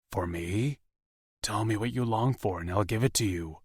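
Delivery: soft and concerned, lightly voiced man. A narrator's reading. Darius voiced again.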